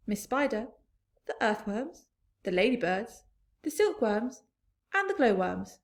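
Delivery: ordinary delivery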